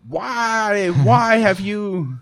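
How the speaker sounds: put-on high, slow teen whine; whiny teenager. Son as future teen.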